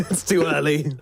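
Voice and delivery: talking through a laugh. Real, not acted. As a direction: laugh while saying it. Laughing at co-caster's impression.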